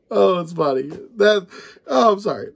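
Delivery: usual speaking voice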